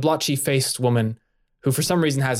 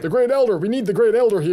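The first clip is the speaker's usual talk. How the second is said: high, loud calling out; excited shout; 11 semitones higher, 4 dB louder, faster than usual. Acted. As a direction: yell for the elder urgently. Villager shouting for elder.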